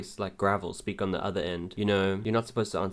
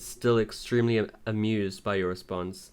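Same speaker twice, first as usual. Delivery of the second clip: ordinary delivery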